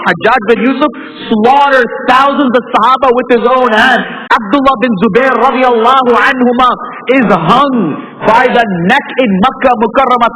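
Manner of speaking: high, loud, impassioned preaching; fiery preaching. Acted. Preacher rousing the congregation about unpunished tyrants, at full force. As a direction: drive it home from the pulpit.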